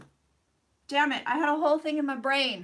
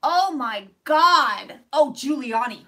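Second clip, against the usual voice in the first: surprised; 8 dB louder, slower than usual. Real, not acted. Loud slow reaction.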